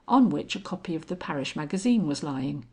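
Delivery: plain reading voice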